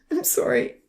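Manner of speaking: high, tearful apology; choked, pained and pitched high; choking on a sob. A narrator's reading. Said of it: "choking on another sob".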